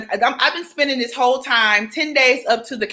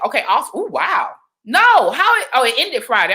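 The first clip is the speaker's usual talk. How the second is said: usual speaking voice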